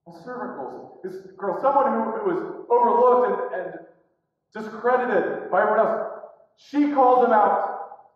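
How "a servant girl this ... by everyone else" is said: ordinary talk, nothing marked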